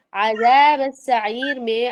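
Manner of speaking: عذاب السعیر stretched slowly with clear letters; teacher modelling عذاب السعیر; slow tajweed recitation. Shown as a demonstration. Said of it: "اس کو کس طرح سے پڑھیں گے صحیح طرح سے".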